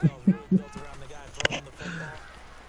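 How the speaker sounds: soft, high, airy laughter; wheezy laugh. Real, not acted. Still cracking up.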